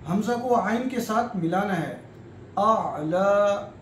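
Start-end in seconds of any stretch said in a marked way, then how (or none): 2.6-3.7 आला with a throaty ayn at the start, drawn out long; ayn sound. Shown as a demonstration. Teacher demonstrating the correct ayn in आला. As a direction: stretch आला very slowly from the throat. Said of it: "ऐसे पढ़ना है".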